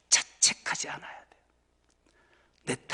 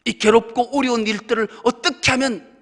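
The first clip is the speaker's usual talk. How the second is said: loud, higher, fervent crying-out prayer; earnest prayer; 5 semitones higher, 11 dB louder than usual. Shown as a demonstration. Believer praying loudly to God for strength, fervent. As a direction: cry out to God asking how, at full force. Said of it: "어떻게라고 물어야 돼요".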